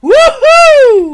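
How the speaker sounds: loud, high triumphant cheer; shouted whoop of pure delight; joyful whoop. Acted. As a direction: let out a full victory whoop. Brando celebrating her yes.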